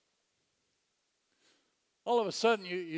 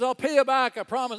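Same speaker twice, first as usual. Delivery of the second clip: pleading; 5 semitones higher, 8 dB louder than usual. Acted. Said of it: "the guy pleads and says".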